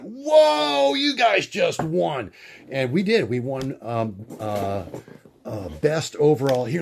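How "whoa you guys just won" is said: crowd amazed. Retold or imitated. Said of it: "everybody who was there just went".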